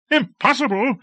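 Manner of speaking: very high outraged question; incredulous cry. A narrator's reading. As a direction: throw the word back in disbelief. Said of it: "cried Lord Fayed".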